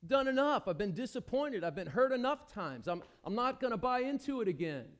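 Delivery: loud, exasperated, high and fed up; bitter and fed up, voice rising high; fed-up outburst. Acted. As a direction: throw up your hands, done with it. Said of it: "Are they gonna say".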